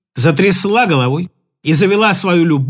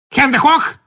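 sharp German command, higher; German shout; 5 semitones higher than usual. Acted. Stefanie crying out in German at them. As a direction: bark it like a German soldier. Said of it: "вскрикнула Стефани на чистейшем немецком".

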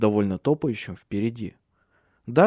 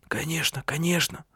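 soft, higher-pitched uncertain mutter; hesitant, hushed mumbling; 6 semitones higher, 5 dB quieter than usual. A narrator's reading. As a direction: mumble it softly, unsure of your welcome. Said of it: "бормотал он, неуверенно входя".

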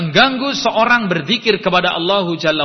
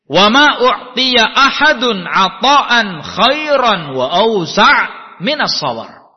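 slow, louder solemn Arabic recitation; hadith recitation; 4 semitones higher, 5 dB louder, slower than usual. Acted. Preacher reciting a hadith to his listeners, slow and solemn. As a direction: intone the Prophet's words slowly and deliberately. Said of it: "Rasulullah sallallahu alaihi wasallam bersabda".

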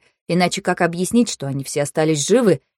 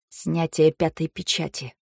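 barely audible, slow murmur; hushed dread, softer than the quarrel; very quiet whisper; 2 semitones lower, 7 dB quieter than usual. A narrator's reading. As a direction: whisper it so only the nearest hear. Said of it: "тихо-тихо прошептал Сяо".

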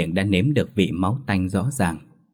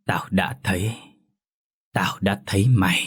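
low, hollow ghost voice; a booming ghost, hollow and low; deep booming voice. A narrator's reading. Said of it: "giọng ồm ồm nói".

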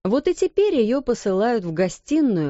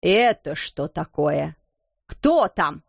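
angry shout; 4 semitones lower, slower than usual. Acted. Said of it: "гневно крикнула она".

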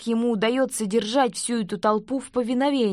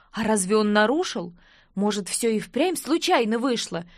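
plain reading voice

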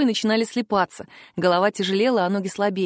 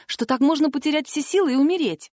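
ordinary delivery

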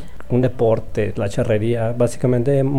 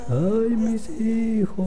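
lamento lento y agudo, más suave, voz distinta; lamento de La Llorona; 8 semitones higher, 5 dB quieter, much slower than usual. Retold or imitated. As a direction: gemir como un fantasma que llora a sus hijos. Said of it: "vaga por las calles gritando". (llorona lamentándose despacio)

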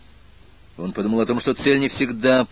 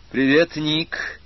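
bright hello, higher and louder, drawn out; light angelic greeting; 7 semitones higher, 5 dB louder, much slower than usual. A narrator's reading. Annabel answers the aspirant's phone call, light drawn-out greeting. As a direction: greet lightly, lifting the pitch and stretching it. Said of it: "Легко, от имени и по поручению всего города ангелов".

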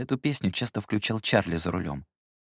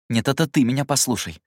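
higher, forceful interruption; cutting him off; 5 semitones higher, 4 dB louder than usual. A narrator's reading. Narrator interrupts Jerome who grabbed him, cutting him off. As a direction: break in and seize the floor. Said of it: "оборвал я".

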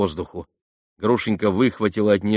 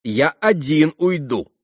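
raised, emphatic sarcasm; loud sardonic retort; caustic teasing; 6 semitones higher, 5 dB louder than usual. A narrator's reading. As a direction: needle them with a biting jibe. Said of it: "язвительно подшутил Ракитин".

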